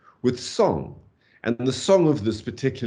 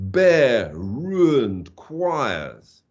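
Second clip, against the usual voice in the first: very slow, equal weight on each word of "Berührend choirs"; long, separate, weighty beats through "Berührend choirs"; three stressed syllables; 3 dB louder, much slower than usual. Shown as a demonstration. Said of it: "begins with three stressed syllables".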